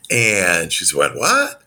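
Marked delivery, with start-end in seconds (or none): 1.2-1.6 high, rising, startled question; mock surprise. Client taken aback by coach calling it a blessing, surprised. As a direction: act out a client's baffled reaction.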